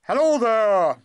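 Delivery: high, loud call from outside. Acted. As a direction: call out brightly to the cave. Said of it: "called out".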